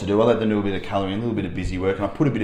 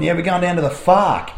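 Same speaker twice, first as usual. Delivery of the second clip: put-on excited kid; 5 semitones higher, 6 dB louder than usual. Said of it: "one of the kids has got a bit excited and gone".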